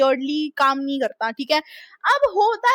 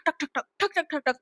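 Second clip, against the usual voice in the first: quiet, soft knock knock knock; soft imitated knocking; 7 dB quieter, faster than usual. A midnight knock at the door, imitated softly.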